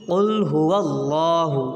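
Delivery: slow, drawn-out recitation; Quran recitation. Shown as a demonstration. Teacher joining the spelled syllables, slow recitation. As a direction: stretch the long vowel as in recitation.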